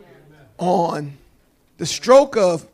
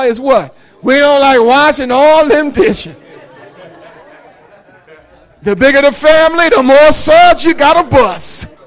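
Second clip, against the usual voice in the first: loud, rising preaching; 6 semitones higher, 9 dB louder than usual. Acted. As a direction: preach it to the congregation. Preaching at full force.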